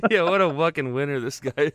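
usual speaking voice

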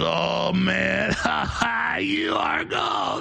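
high cackling mock shout, dragging the last words slowly; imitating laughing taunt. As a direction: ham up a friend's gleeful laughing jeer. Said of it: "He starts laughing".

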